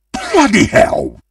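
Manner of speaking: high, outraged curse; hammy high cry of disbelief; outraged exclamation. Acted. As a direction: spit it out in high dismay. Reacts to losing the game.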